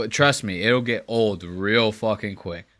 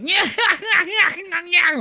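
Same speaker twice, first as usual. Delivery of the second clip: high cackling yeah yeah; laughing yeah yeah; slower than usual. Retold or imitated. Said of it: "when he's laughing".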